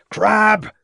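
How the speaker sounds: a high, loud call for help; urgent shout. A narrator's reading. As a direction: yell his constable's name. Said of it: "shouted Ravenscroft".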